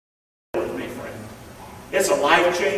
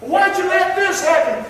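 hurt son; 11 semitones higher, 4 dB louder than usual. Acted. Son questions God.

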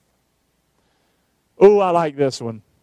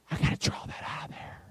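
breathy whisper under the breath; whispered aside; 15 dB quieter than usual. Real, not acted. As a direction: mutter it to yourself in a whisper.

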